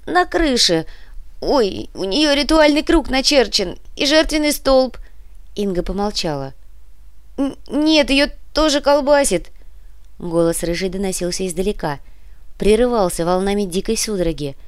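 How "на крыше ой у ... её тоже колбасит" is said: shaky high voice. A narrator's reading. Shivering witch on phone.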